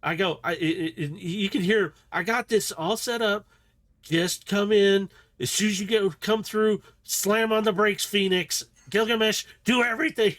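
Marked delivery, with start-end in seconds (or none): 2.1-10.3 higher, louder frantic orders; freaking out. Acted. Kiara ordering the crew before the ping, frantic higher orders. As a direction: rattle off commands in a panic. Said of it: "you see her freaking out".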